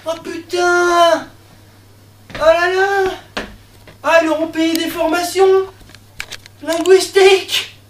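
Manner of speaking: high, loud, drawn-out marvelling; mock amazement. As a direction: exclaim as if wildly impressed. Mock amazement at guard's words.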